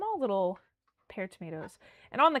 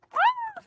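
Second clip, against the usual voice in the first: very high, loud delighted squeal; excited squeal; 7 dB louder than usual. Real, not acted. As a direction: let out a happy shriek. Squeals over ingredients.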